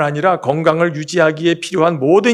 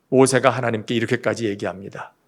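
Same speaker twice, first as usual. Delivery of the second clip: ordinary talk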